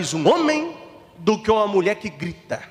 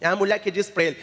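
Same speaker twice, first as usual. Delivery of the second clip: usual speaking voice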